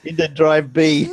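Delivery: high, loud computer prompt voice; old speech synthesizer. Pretend. Mock floppy disk install prompt.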